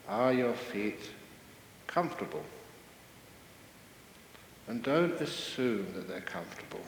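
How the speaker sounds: calm, even guidance with long pauses; guided meditation. Real, not acted. Teacher guiding seated listeners with eyes closed, calm and measured. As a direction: gently lead them into body awareness, unhurried.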